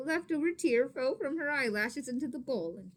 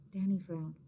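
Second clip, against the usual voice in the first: ordinary delivery, nothing marked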